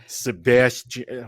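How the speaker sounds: flat American Sebastian, slow and drawn out; American accent. Shown as a demonstration. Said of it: "let me put on my most American accent".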